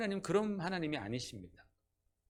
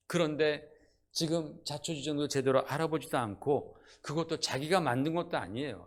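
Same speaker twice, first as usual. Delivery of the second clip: ordinary talk, nothing marked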